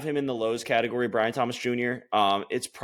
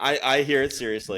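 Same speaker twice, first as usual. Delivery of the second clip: laughing; 4 semitones higher than usual. Real, not acted. Laughing along at the joke.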